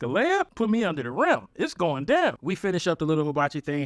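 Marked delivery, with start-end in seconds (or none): 0.0-2.4 put-on hyped brag to the girl; excited boast. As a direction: hype yourself up. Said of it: "And I'm like".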